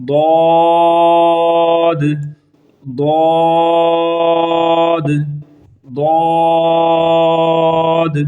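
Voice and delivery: ضاد drawn out slowly, thick and heavy; heavy letter sound. Shown as a demonstration. Teacher drilling the heavy letter for students, slow and thick. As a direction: stretch ضاد with a full, heavy emphatic sound. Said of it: "موٹا پڑھیں گے".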